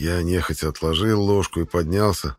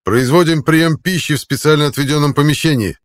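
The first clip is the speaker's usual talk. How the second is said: high, loud drilled report; loud formal report; 8 semitones higher, 6 dB louder than usual. A narrator's reading. Soldier reporting to centurion.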